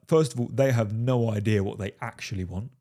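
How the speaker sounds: ordinary talk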